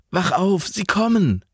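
high, urgent warning; urgent alarm. A narrator's reading. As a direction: rouse a sleeper in alarm. Alarm, waking her before attack.

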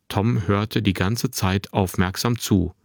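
plain reading voice

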